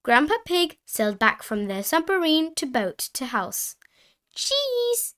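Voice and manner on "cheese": put-on high piping child voice; Peppa Pig. A narrator's reading. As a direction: pipe up as a little piglet. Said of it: "said Peppa said".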